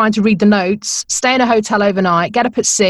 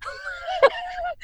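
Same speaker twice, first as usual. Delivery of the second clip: high, shrieking laugh; burst of laughter; 9 dB quieter than usual. Real, not acted. Presenter laughing at her own stunned reaction, high shrieking laugh.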